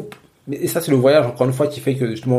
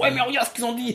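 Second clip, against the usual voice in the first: higher, softer voice quoting a friend's complaint; quoted friend voice; 11 semitones higher, 5 dB quieter than usual. Retold or imitated. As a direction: mimic an indignant friend. (voix d'ami indigné, plus aiguë)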